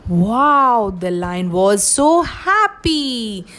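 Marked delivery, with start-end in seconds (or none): none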